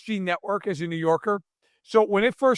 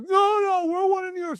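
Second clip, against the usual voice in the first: much higher, whining mock plea; whiny Buffalo fan; 13 semitones higher than usual. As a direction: play a Buffalo fan begging to belong, whiny and high. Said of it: "I always love people in Buffalo".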